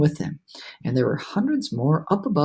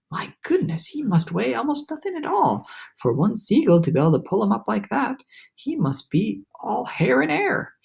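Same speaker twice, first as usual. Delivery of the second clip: higher, amused wonder; delighted James; 6 semitones higher than usual. A narrator's reading. James watching the cloud man fly off, delighted. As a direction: marvel lightly.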